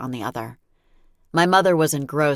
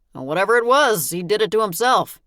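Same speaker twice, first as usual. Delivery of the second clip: father's voice; 7 semitones higher, 6 dB louder than usual. Acted. Father covers for his daughter.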